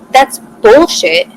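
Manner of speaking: slow, loud, high dismissal; scornful anger. Retold or imitated. Dismissing his excuse that he liked her, scornful and loud. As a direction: spit it out slowly in contempt. Telling off the guy.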